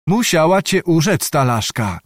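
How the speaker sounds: usual speaking voice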